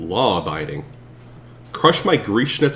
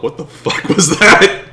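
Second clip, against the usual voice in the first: loud, high outburst of disbelief; real startled shout; shocked; 7 semitones higher, 10 dB louder than usual. Real, not acted. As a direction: blurt it out in shock. Shocked at weird voice.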